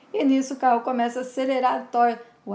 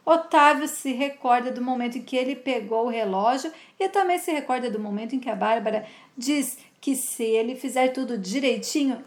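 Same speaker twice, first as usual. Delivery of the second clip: normal talking voice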